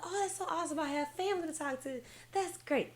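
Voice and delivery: high, soft gushing; retold excitement. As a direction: gush lightly in a high, small voice. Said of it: "So I was excited".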